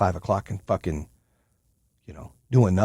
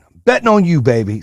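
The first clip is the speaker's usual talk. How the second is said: hyped pep talk; 6 semitones higher, 5 dB louder than usual. Retold or imitated.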